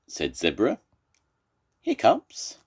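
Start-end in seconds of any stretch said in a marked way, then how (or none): 1.8-2.6 very high, surprised-sounding question; asking. Acted. Miss Pig asks Zebra about his trouble, high-voiced. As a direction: pipe up in a high voice. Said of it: "asked Miss Pig".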